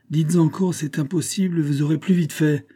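high-pitched sarcastic irritation; exasperated. A narrator's reading. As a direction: taunt him in rising frustration. Exasperated king taunts musketeer. (exaspération, voix haut perchée)

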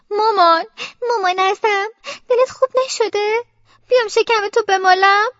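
high, whimpering appeal to mum; childish teary concern; tearful worried child. Acted. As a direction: plead anxiously, close to tears. Frightened child fusses over ailing mother.

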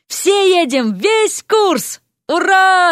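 high, jubilant whooping; jubilant cheer. A narrator's reading. William and the necromancers celebrate the trip, jubilant cheer. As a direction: cheer it out to the whole room. Happy student announcing a trip.

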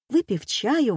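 ordinary delivery, nothing marked

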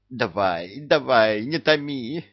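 impatient push. A narrator's reading. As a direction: hurry him up, bursting with impatience. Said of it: "аж подпрыгнул от нетерпения".

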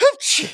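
sharp voiced sneeze; a big sudden sneeze. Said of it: "чихнув". (чих)

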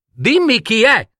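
prince urgently demanding an answer; demanding cry. A narrator's reading. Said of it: "gridò il principe". (grido acuto e imperioso, non troppo forte)

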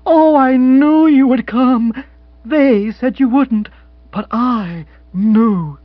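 high, loud and slow with a catch of tears; tearful joyful outburst; overjoyed. Acted. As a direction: pour out long-held hope. Overjoyed reunion, emotional.

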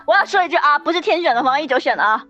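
normal talking voice

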